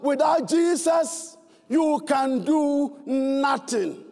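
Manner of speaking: slow, weighty build to a drawn-out "nothing"; stress on nothing. Real, not acted. Preacher telling the congregation the church's theme, stress on "nothing". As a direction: spell it out slowly, leaning hard on "nothing". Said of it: "Emphasis on the word nothing".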